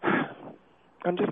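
breathless, gasping between words; struggling to catch her breath. Real, not acted. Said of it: "I'm trying to catch my breath".

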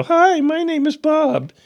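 high, cracked tough-guy imitation; mock a tough guy whose voice breaks high; cracking voice. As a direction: voice cracking upward in mockery. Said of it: "your voice cracks like that".

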